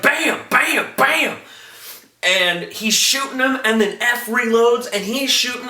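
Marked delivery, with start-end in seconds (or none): 0.0-1.4 high, punchy vocal gunfire; imitated gunshots. Satrakian jumps out shooting, imitated gunshots. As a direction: fire off shots with the voice, pitched up. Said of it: "is just like".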